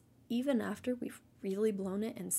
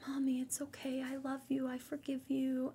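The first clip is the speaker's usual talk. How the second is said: higher-pitched imitation of a little boy forgiving his mom; forgiving young son; 5 semitones higher than usual. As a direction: lightly voice a small child reassuring Mommy. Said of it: "he threw his arms around my neck".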